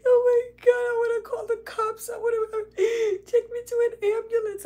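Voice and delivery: high, loud, frantic retold panic; shrill high-pitched freak-out to a teacher; panicked high pleading. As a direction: squeal it like a terrified stoned teen. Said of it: "Like, I'm freaking out".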